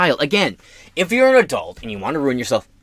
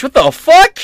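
much higher, loud drawn-out shout; outraged disbelief; 13 semitones higher, 10 dB louder, much slower than usual. Real, not acted. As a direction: stretch the outrage out slowly.

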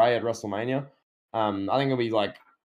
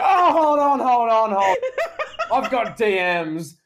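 high, loud, gleeful interruption; excited Seth Rollins; 10 semitones higher, 6 dB louder than usual. Retold or imitated. Seth Rollins interrupting the segment, loud high gleeful call. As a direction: burst in high and loud, laughing, drawing out the last line. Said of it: "He's like".

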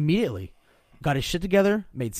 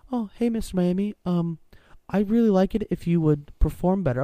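higher, overly sweet and timid request; mock polite; 5 semitones higher than usual. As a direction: simper it like a meek boss. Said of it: "if you're like".

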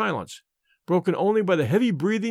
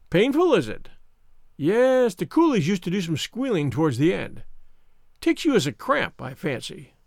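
Mister Smith, smug. Acted. Visitor who sniggered at sick Holmes, smug. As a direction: sneer at the helpless patient. Narrator voices sneering visitor.

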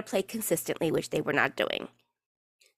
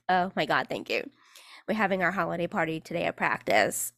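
ordinary talk

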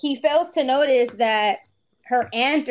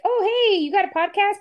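higher-pitched imitation of chirpy greeting; retold eager greeting; 7 semitones higher than usual. Women at the river hailing a podcaster, imitated eagerly. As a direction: pipe up like an interested stranger.

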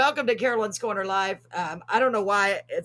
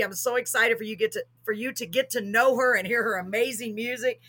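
usual speaking voice

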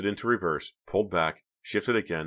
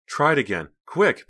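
high, loud, urgent; crying out; 10 semitones higher, 8 dB louder than usual. A narrator's reading. Pam urges Clay to start the car, crying out. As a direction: urge the driver on in alarm. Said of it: "Pam cried".

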